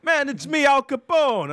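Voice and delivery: put-on Jamaican accent. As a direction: play a Jamaican rapper in a Jamaican accent. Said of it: "He's like".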